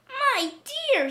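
put-on very high, startled old woman; old woman crying out. A narrator's reading. Old woman sees the cats coming, high cry. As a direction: exclaim it high in dismay. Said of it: "she cried".

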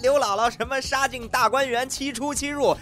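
high-pitched gleeful jibes; mocking teasing. Ridiculing a friend's garbled Three Kingdoms tale, gleeful mockery. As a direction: rattle off the friend's blunders in a high voice.

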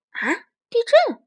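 high, slow startled cry; alarmed creature. Acted. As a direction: gasp in fear.

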